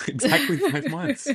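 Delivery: high, slow, laughing through the words; laughing excitement. Real, not acted. Friend realising the reunion date, laughing high and slow. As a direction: burst out laughing as it clicks.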